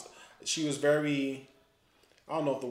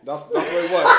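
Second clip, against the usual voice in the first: ordinary talk, nothing marked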